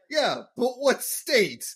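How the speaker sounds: high, slow comic impression; hammed-up, high-pitched mystery man; Rodney Dangerfield. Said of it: "gonna do it the rest of this episode".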